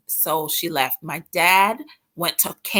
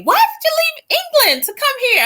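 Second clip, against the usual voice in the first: high, incredulous questioner; mock incredulous asker; 4 dB louder than usual. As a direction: pitch way up, playing the nosy asker. Mocking people's question.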